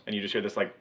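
ordinary talk

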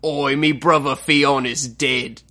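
slow Ironborn islander accent, mock grave; lightly done Ironborn sailor. Answering his cohost's bit in a mock Ironborn accent. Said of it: "the accent keeps drifting".

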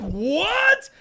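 high, astonished exclamation; mock astonishment. Retelling own reaction to the artist's Naruto story, astonished.

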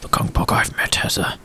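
lightly voiced old gent, hushed in places; a hint of a sorrowful old gentleman remembering; wistful old gentleman. [name] recalls a tragic friend.

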